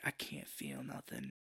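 low, slow dying murmur. Retold or imitated. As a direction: let it drain out, slow and faint. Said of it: "that's where he's like".

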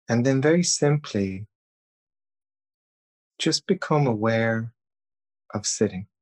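calm, even meditation voice with long pauses; guided meditation. Acted. Leading listeners through breathing and self-thanks, calm guided meditation. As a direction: guide them gently, leaving long silences between phrases.

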